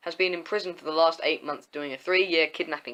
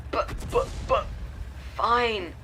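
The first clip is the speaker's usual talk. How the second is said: stammer, then give in on a raised pitch; stuttering; 6 semitones higher, slower than usual. A narrator's reading. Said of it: "Aaron stutters".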